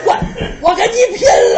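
a high, loud cry of all-out rage; furious shout. Acted. Enraged man shouting at his rival, furious shout. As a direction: roar it like you'll fight to the death. Character threatens to fight.

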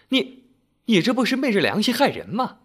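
shrill with outrage at her husband; shocked anger. A narrator's reading. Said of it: "她生气地说".

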